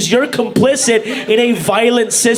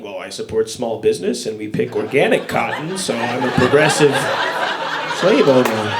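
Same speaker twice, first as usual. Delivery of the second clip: smug slave owner; 10 semitones lower, 4 dB quieter than usual. Retold or imitated. A self-satisfied slave owner boasting, low smug voice. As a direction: boast of your virtue smugly.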